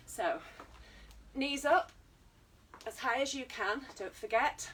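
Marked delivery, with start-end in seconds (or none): 1.3-1.9 called-out cue. Real, not acted. Calling out exercise cue.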